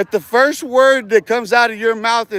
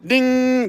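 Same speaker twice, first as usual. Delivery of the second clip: higher, sung-out ding like a little bell; 5 semitones higher than usual. Retold or imitated. Mocking the trolls by chiming like a bell. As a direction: ring it out like a doorbell. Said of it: "northern bell ringing".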